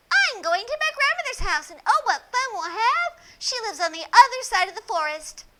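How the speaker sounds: put-on a happy girl chattering to a stranger; cheerful little girl. Little girl tells the wolf where grandmother lives. Red's voice in story.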